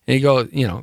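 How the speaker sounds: ordinary talk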